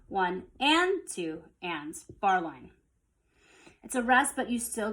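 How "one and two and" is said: slow count, first "and" lifted over "One" and "two"; emphasis on AND. Shown as a demonstration. Teacher repeating the offbeat emphasis, count stressing "and". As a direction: count slowly, punching the first "and". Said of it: "The emphasis is on that and".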